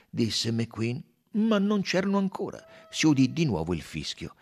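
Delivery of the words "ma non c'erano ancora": ordinary delivery, nothing marked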